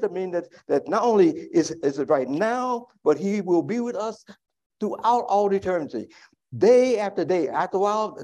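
ordinary talk

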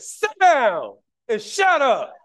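high, loud, slow barked command; mock police officer, slow and forceful; stern officer's order. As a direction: bark it like a lady cop putting you in your place. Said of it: "that's how she said it".

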